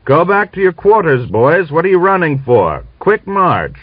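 loud, high commanding shout; officer yelling at running soldiers; shouted order. A narrator's reading. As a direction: bark the order across the square. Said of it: "He shouted to them".

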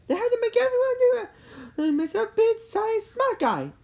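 put-on high-pitched girl rival voice; accusing girl character. A narrator's reading. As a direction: needle him in a high voice. Cindy accusing Jimmy in story.

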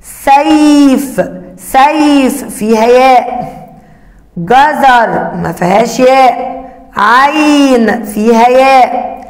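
put-on each word stretched slowly for kids; teacher slowly checking each picture; slow sounding out. Shown as a demonstration. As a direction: drag the words so children hear the ياء. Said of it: "صوت حرف الياء".

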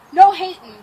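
sudden loud burst; loud warning. Real, not acted. Climber to onlookers before round two, loud warning. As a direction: tell them off loudly.

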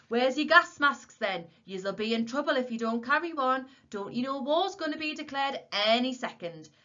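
high, loud, indignant telling-off; loud scolding. A narrator's reading. Old warden to Tom about gas masks, loud scolding. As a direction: berate them at full voice.

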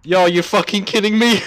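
high, loud, laughing; laughing in disbelief. Real, not acted. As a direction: crack up in disbelief. Disbelieving laughter after the play.

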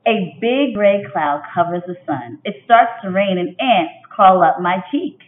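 expressive picture-book read-aloud; reading to children. A narrator's reading. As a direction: read it like storytime for young kids. Teacher reads picture book aloud.

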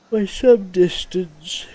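words stretched through a big yawn. Real, not acted. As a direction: yawn mid-phrase, dragging it out.

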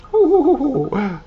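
high hooting owl laugh. Acted. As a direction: hoot like a giggly owl.

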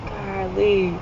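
ordinary delivery

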